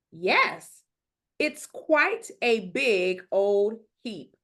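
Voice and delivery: usual speaking voice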